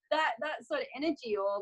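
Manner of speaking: ordinary talk, nothing marked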